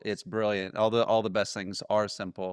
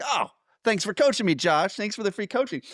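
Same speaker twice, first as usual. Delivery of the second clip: high, louder mock-grateful exclamation; playful gushing thanks; 9 semitones higher, 4 dB louder than usual. Guest thanking Josh for free coaching, gushing. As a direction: lift the pitch and gush. Acts out grateful reply to host.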